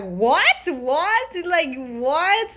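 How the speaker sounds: mock-shocked rising questions. Everybody in the movie theater reacting, mock-shocked. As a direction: imitate a stunned crowd, each what rising. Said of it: "was shocked and just like".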